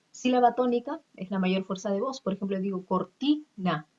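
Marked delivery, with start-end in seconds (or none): 2.9-3.9 cortina split up, TI standing out; stress on TI. Shown as a demonstration. Teacher showing the tonic syllable, stress on TI in cortina. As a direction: lean on the TI in cortina. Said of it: "La que suena más de las tres sílabas es ti".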